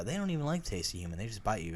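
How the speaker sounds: low, soft, quick mock quote. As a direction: rattle it off like a know-it-all. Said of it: "I always like the".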